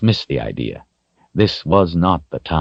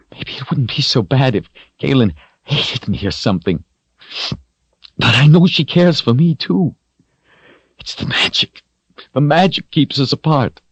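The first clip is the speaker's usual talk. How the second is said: higher, tearful confession; 9 semitones higher than usual. A narrator's reading. Richard cries about the girl he loves. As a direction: voice cracking with sorrow.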